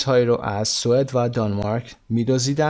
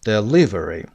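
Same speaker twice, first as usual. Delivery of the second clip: Delivery stressed on LI, falling away after; 3 semitones lower, slower than usual. Shown as a demonstration. Teacher repeats to confirm the stress, de-LI-very. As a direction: lean on "li" in "Delivery". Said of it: "قسمت لی".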